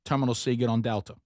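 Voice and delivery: brisk New York local giving directions; imitation New York subway talk; mock New York accent. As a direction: put on a New Yorker's accent. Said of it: "I started talking like New Yorkers".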